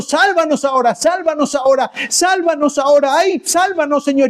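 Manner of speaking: high, repeated imploring; acted tearful plea. Preacher acting out the crowd on that day, mock tearful pleading. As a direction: imitate a weeping crowd begging for rescue.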